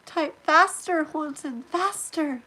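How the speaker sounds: loud, high, playful urging; eager urging. Real, not acted. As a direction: hurry them along eagerly. Urging chatter to hurry.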